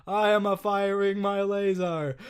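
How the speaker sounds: slow robotic AI voice. Retold or imitated. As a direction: stiff automated monotone. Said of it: "the automated voice that sounds like this".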